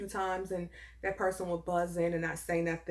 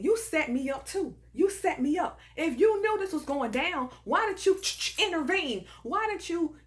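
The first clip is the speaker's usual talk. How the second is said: accusing; 6 semitones higher than usual. Acted. Confronts the absent girlfriend as chick.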